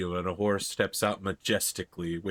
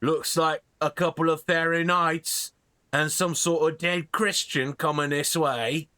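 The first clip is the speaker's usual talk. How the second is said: slow, higher dopey cartoon bellow; lumbering troll guarding a bridge; 5 semitones higher than usual. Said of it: "and the troll says".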